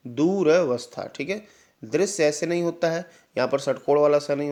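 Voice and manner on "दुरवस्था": दुरावस्था slowly as duu-ra, vas-tha; slow correct model. Shown as a demonstration. Teacher repeating for students, दुरावस्था slowly with short ra. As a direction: draw out दुरावस्था, keeping "ra" short. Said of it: "दुरावस्था होता है".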